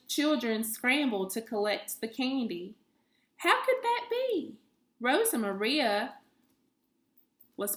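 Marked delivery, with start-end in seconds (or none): 3.4-4.6 puzzled wonder. A narrator's reading. Puzzled narration.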